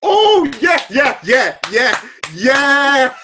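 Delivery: loud, high yeah yeah; excited realisation. Real, not acted. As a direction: it suddenly clicks, say it loud.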